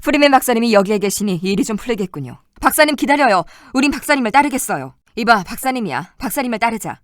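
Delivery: ordinary delivery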